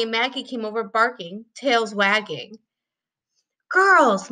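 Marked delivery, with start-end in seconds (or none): 3.7-4.3 higher, louder sharp call. A narrator's reading. Mom sharply calling off the barking dogs. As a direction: snap at the dogs to settle them. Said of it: "Mom said sharply to dogs".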